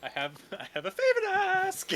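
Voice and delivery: high calling barmaid voice. Acted. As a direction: call across the tavern sweetly. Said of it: "she calls over to a girl".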